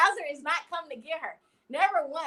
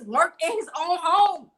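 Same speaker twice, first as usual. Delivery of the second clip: indignant; 7 semitones higher, 6 dB louder than usual. Real, not acted. Outraged defense of Bowser.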